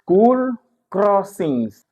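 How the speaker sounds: slow, loud and high "school crossings", stress on "school"; drawn-out "school crossings" for the class; teacher projecting "school crossings" slowly; emphatic model word. Shown as a demonstration.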